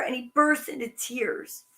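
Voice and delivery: plain reading voice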